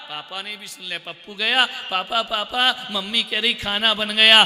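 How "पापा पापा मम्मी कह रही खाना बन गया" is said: louder calling papa, papa; child calling dad. Retold or imitated. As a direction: call out to dad across the room. Child calling his father.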